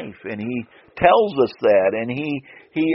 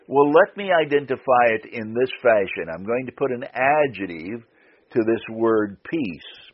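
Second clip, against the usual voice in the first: ordinary delivery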